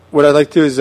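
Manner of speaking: usual speaking voice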